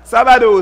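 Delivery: sabado said the Spanish way, sa-BA-do; Spanish word. Shown as a demonstration. Preacher teaching the Spanish word for Saturday, said the Spanish way. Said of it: "le sabbat se dit".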